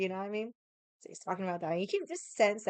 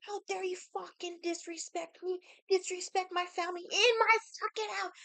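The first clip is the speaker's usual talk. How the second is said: higher, half-shouted furious scream; mock furious yell, pitched way up; retold screaming; 11 semitones higher than usual. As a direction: relive screaming at the guest who disrespected her. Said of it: "I was screaming at him".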